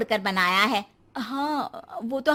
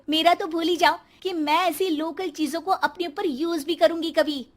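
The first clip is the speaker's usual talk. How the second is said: ordinary talk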